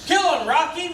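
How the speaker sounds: high mock ringside call; fight fan's cheer. Juror imitating a fan egging on Rocky, high cheering call. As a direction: cheer on a boxer from the crowd.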